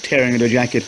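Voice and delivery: ordinary delivery, nothing marked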